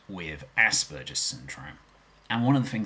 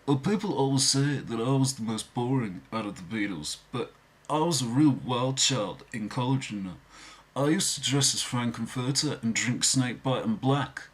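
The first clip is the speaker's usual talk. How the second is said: higher Liverpool accent, boastful; 3 semitones higher than usual. Shown as a demonstration. Beatle boasts to fans, Liverpool accent. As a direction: brag about college wildness in a Liverpool accent. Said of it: "I can impersonate all four of the Beatles".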